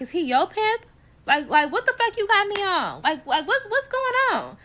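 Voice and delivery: can't believe what she's hearing; incredulous. Retold or imitated. Incredulous outburst at friend.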